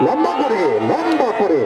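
high excited shout of the pass; excited call. Real, not acted. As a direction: call the long ball out high. Long ball called out live.

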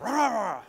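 loud, high mock crowd roar; imitating garbled crowd shout. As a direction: mimic a congregation shouting in a jumble. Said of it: "It sounded like".

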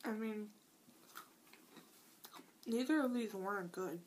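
talking while chewing; chewing cookie. Real, not acted. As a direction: mouth partly full. Seen 2: Licks fingers after bite, chews between phrases while talking.

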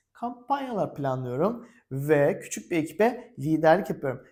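ordinary talk

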